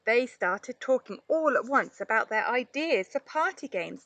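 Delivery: ordinary delivery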